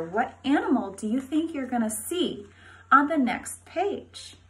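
ordinary delivery

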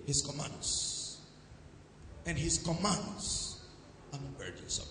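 soft, low slow finish; sudden hush. Real, not acted. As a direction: drop right down after the shout. Drop after shouted climax.

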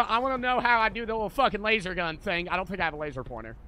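ordinary talk, nothing marked

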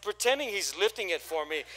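ordinary talk, nothing marked

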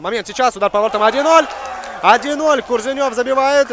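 rising excited shout of the goal; goal call. Real, not acted. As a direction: call the goal loud and high. Commentator calls an early goal. (возбуждённый крик гола)

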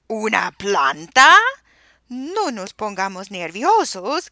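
loud, slow, aghast "Una planta"; shocked shout. A narrator's reading. Parents shout at their plant-loving baby in disbelief. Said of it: "gritaron los padres".

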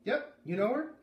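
put-on kid's voice, high and lively; boy's voice. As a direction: answer like a busy young boy. Boy asks Beat.

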